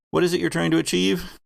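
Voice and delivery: ordinary talk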